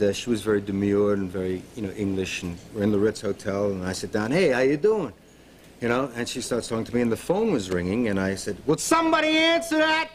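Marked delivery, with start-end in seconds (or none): 4.3-5.1 a brash slob's greeting, louder and higher; mock brash New York hello; brash loudmouth. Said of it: "this chauvinistic slob, loudmouth". 8.7-10.1 much higher and louder rude bark at the room; mock loudmouth yell at the ringing phone. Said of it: "chauvinistic slob, loudmouth".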